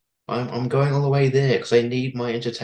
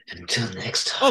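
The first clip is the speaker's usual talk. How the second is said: low, breathy sign-off; sexy voice; 3 semitones lower than usual. Pretend. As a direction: purr it out seductively. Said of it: "sign off in your best sexy voice".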